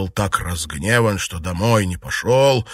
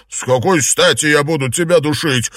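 raised, indignant retort; heated, higher-pitched outburst; angry shout; 5 semitones higher, 4 dB louder than usual. A narrator's reading. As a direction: boil over with anger. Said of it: "вскипев от гнева, закричал".

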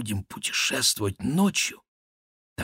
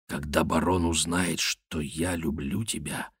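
low, hushed reassurance; soft, lowered lover's voice; whispered; 4 semitones lower, 6 dB quieter than usual. A narrator's reading. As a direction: murmur it low, slowing and softening at the end. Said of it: "прошептал он".

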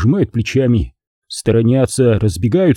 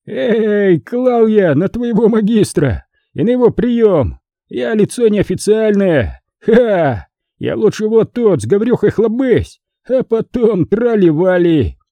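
put-on higher, cocky drunken voice; town drunk; 9 semitones higher than usual. A narrator's reading. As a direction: swagger like a tipsy monk. Drunk monk rebuffs Dmitry.

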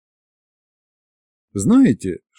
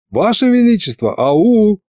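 insistent calling; 8 semitones higher than usual. A narrator's reading. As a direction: call out to someone who isn't listening. Second call, tsar not hearing. (настойчивый оклик, высоким голосом)

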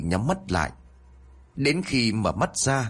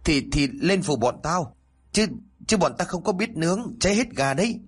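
urgent coaxing; 7 semitones higher than usual. A narrator's reading. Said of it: "cố thúc giục".

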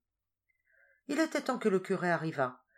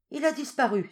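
cried out higher and louder; crowd's cry; 10 semitones higher, 6 dB louder than usual. A narrator's reading. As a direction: everyone shouting at once. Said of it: "s'écria-t-on de toutes parts". (la foule s'écrie, voix haussée)

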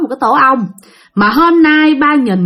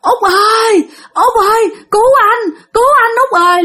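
high-pitched frightened calling out; panicked cry for help; 11 semitones higher than usual. Acted. Frightened father calls to his wife for rescue, high panicked cry. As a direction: call out desperately, pitched way up. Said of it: "cái ba mới kêu".

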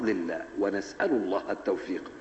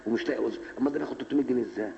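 envious rant; 2 semitones higher, much faster than usual. Retold or imitated. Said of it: "قال".

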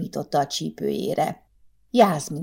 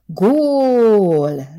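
a big crowd yell of goal; loud goal shout; 5 semitones higher, 7 dB louder than usual. A narrator's reading. Fans in the café roaring a goal, loud shout. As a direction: roar it so the walls shake. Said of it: "remegtek meg újra a falak".

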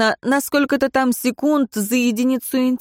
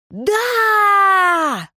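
crowd roar; 11 semitones higher than usual. A narrator's reading. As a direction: cheer back as a crowd. Crowd answers.

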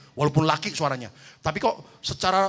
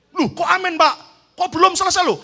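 surprised protest; 12 semitones higher, 10 dB louder than usual. Retold or imitated. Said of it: "saya ngomong gini".

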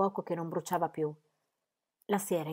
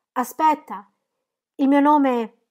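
high, loud urgent call; shouting after someone; 7 semitones higher, 10 dB louder than usual. A narrator's reading. Captive calling after the departing archer, shouting. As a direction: cry out to stop him leaving. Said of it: "Gli gridai".

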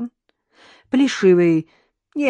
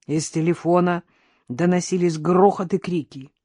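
plain reading voice

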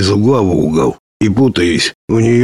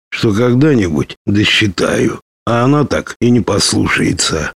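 ordinary delivery, nothing marked

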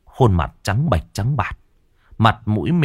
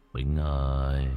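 low, hollow, drawn-out ghostly call; gloomy ghost voice; 7 semitones lower, 8 dB quieter, slower than usual. A narrator's reading. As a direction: call his name like a spirit from the grave, much lower and softer. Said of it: "cất giọng âm u".

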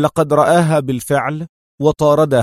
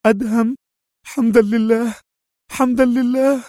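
high, relieved woman's voice; joyful cry; 10 semitones higher than usual. A narrator's reading. Woman sees him awake, high and overjoyed. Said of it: "هاتفة بكل كيانها".

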